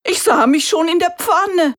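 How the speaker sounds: high, loud rooster voice; relieved rooster. A narrator's reading. Rooster telling the donkey he thought he was doomed, high relieved voice. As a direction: laugh off the narrow escape.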